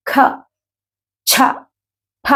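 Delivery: ఖ, ఛ, ఫ with a strong puff of breath; aspirated letters. Shown as a demonstration. Teacher demonstrating aspirated letters to students. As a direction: push air out hard on ఖ ఛ ఫ. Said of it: "గాలి బయటికి బలంగా వస్తుంది".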